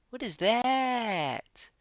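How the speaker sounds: high, drawn-out disgusted question; stretched-out, high-pitched revulsion; grossed-out disbelief. Real, not acted. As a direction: squeal it slowly, appalled. Grossed out by hamstrings on screen.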